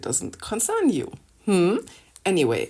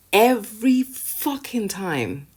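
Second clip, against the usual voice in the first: "Every fucking time" dragged out slow, pitched high; exasperated, drawn-out "Every fucking time"; emphatic exasperation; 5 semitones higher, 4 dB louder, slower than usual. Real, not acted. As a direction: stretch each word of "Every fucking time".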